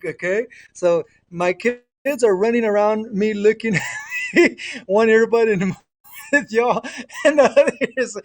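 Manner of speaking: real chuckles breaking up the words; talking through laughter. Real, not acted. Parent telling listeners about kids running around, laughing. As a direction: keep talking as giggles break in.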